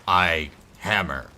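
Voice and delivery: low dim-witted brute; caveman voice. Pretend. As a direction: grunt it like a caveman. Dumb barbarian persona.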